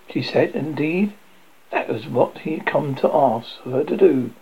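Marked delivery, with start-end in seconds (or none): none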